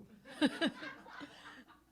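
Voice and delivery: high, loud laugh through tears; a laugh of embarrassed relief; teary laugh. Real, not acted. Laughing after mentioning crying.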